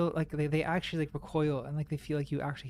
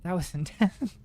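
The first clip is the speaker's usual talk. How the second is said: laughing; 2 semitones higher, 3 dB louder, faster than usual. Real, not acted. Laughing after a scare.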